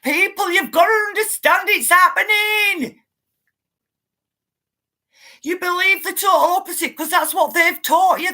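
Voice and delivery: angry rant. Real, not acted. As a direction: lash out at the doubters. Ranting at doubting viewers.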